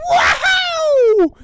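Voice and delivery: very high loud shouted "Wow"; mock amazed cry. Retelling Elliot seeing E.T. alive, shrieking wow. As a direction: shriek it like a thrilled kid. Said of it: "Elliot's like".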